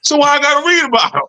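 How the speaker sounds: high, fast exasperated gripe; hammed-up protest, rushed and high; mock exasperated student. Student protesting to the teacher, high quick mock gripe.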